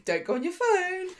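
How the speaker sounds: high-pitched mock warning; retold telling-off in a put-on high voice; Fizz. Co-host voicing Fizz warning Hope, high mock scolding. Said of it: "So Fizz is like".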